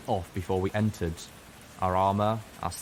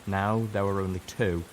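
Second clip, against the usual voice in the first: plain reading voice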